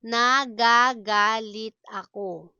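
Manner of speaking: firm, deliberate syllables. Shown as a demonstration. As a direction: sound out each syllable for learners. Slow model, then natural speed.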